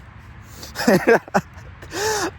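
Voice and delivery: a sudden high-pitched squealing giggle. Real, not acted. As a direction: burst into a high embarrassed giggle. Giggles after blowing a kiss.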